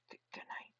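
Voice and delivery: put-on shy girl whispering; timid whisper. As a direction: stammer it out in a soft whisper. Shy Hanako voiced by reader.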